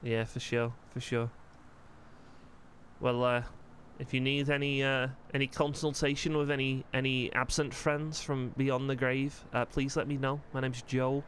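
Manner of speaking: put-on light Scouse accent as the character Joel; easygoing Liverpool lad in a Scouse voice. Joel chatting with Cyrus, relaxed Scouse accent.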